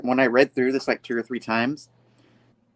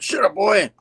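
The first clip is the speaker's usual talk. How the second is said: acted angry retort; 9 semitones higher, 4 dB louder than usual. Acts out Spidey silencing villain.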